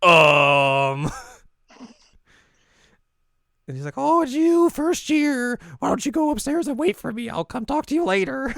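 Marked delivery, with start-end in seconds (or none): 0.1-1.1 loud, drawn-out stunned um; awkward shock. Retold or imitated. Acted awkward shock at encounter. 3.9-8.5 very high, loud peppy voice; chirpy senior girl. Retold or imitated.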